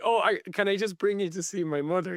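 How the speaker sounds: put-on eager cabbie in a light Dublin accent. Taxi driver begging Bill to meet his mother, light Dublin accent. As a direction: beg him like an excited Dublin cabbie. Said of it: "thick working class, I guess, Dublin accent".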